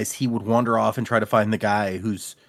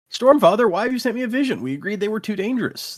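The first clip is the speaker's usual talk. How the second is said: high, indignant retold complaint; mock protest; 10 semitones higher, 3 dB louder than usual. Dalinar questioning Stormfather about the vision, high mock protest. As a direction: pitch it up, pleading in light protest.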